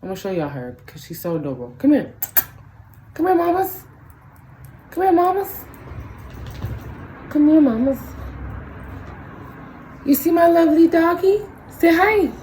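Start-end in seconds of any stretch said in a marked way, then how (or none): 1.8-12.3 very high cooing pet voice. Real, not acted. Owner cooing at her Chihuahua for the camera. Baby talk to her dog.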